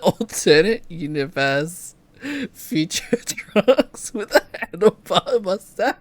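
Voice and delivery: laughing while talking. Real, not acted. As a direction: giggle through the words. Laughing through description.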